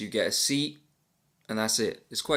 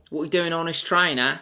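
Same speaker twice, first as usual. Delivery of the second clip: higher-pitched, hammed-up Australian police officer; mock Australian accent; 7 semitones higher, 4 dB louder than usual. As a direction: put on a rough-and-ready bad Australian accent. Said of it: "That's my Austra-, Australian accent".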